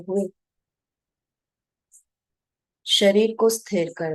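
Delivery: measured meditation guide, long pauses between short phrases; guided meditation. Real, not acted. Guide to meditators watching their breath, calm guided meditation. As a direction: guide them unhurriedly, phrase by phrase, leaving long silences.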